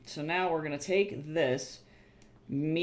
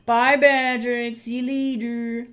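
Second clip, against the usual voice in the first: cheery goodbye voice; 10 semitones higher, 8 dB louder, slower than usual. Pretend. Waving off a character, sing-song.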